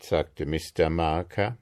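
ordinary delivery

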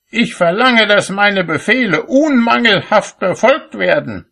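very high, loud rebuke; yelling at someone. A narrator's reading. Cobbler yells at apprentice handing him a shoe, high and furious. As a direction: shout it accusingly, pitched right up. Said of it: "schrie er ihn an".

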